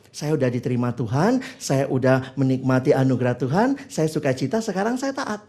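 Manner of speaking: a contented believer at peace; calm assurance. Retold or imitated. Preacher voices a secure believer, calm and low.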